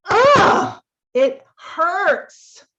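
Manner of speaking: a sudden high, loud cry of pain, then the words. Retold or imitated. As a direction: let the hurt burst out in a loud high wail. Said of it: "the heart that is like".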